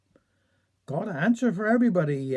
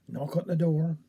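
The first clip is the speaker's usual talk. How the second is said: ordinary delivery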